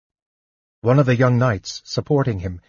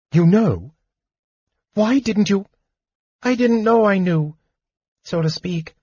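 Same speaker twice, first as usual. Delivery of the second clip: a much higher, nervous kender voice; a jittery little kender, stammering; small excitable kender, flustered; 10 semitones higher than usual. Acted. Tas the kender's voice.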